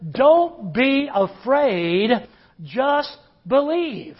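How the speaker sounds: slow, drawn-out comfort; preacher voicing Jesus to the frightened father, slow and steady; slow reassurance. Slow, deliberate reassurance.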